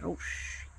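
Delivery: breathless whispered curse; whispered panic. Retold or imitated. Teen realizing dad has caught her on the phone, whispered. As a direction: hush it, caught out.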